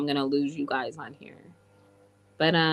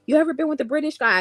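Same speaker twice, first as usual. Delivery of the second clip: high, fast, loud quoted question; quoting a curious asker; 9 semitones higher, 5 dB louder, much faster than usual. Retold or imitated. Viewer asks about British guys, quick high quote. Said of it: "They're like".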